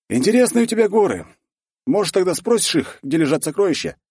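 high, loud mocking question; needling dwarf. Voiced dwarf in dialogue.

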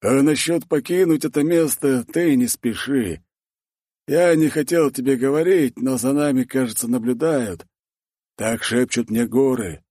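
dwarf Torgvald, cautioning. Acted. Voiced dwarf in dialogue.

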